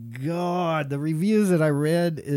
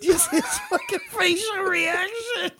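high, laugh-choked words; squeaky laughing wheeze; helpless laughter; 11 semitones higher than usual. Real, not acted. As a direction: barely get it out through laughing. Laughing at friend's face.

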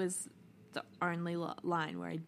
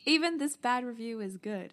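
exasperated outburst; 4 semitones higher, 7 dB louder than usual. Real, not acted. Outraged at a soft review.

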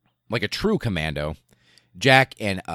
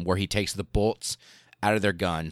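ordinary talk

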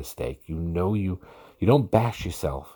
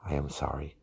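barely voiced, softer sorry; whispered apology; 3 semitones lower, 7 dB quieter than usual. Shown as a demonstration. Wife whispers her apology to her husband, softly. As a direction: breathe the sorry out gently.

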